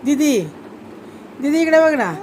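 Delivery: high-pitched calls to get attention; calling out. Real, not acted. Mother calling her little girl to look, calling out.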